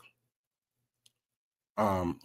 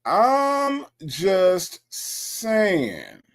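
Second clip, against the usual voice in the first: very slow, higher-pitched stretched words; drawn-out disclaimer; 5 semitones higher, much slower than usual. Pretend. Host defending the plantation bit, exaggeratedly drawn out. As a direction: stretch every word way out.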